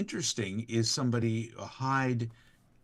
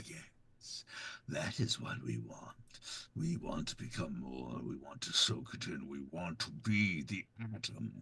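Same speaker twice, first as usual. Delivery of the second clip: much lower and softer, hushed; Voice of Reason; 7 semitones lower, 10 dB quieter than usual. Acted. Institute persona quietly urging becoming the atom, low and soft. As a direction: purr it low and close, soothing. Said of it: "Yeah, the Voice of Reason".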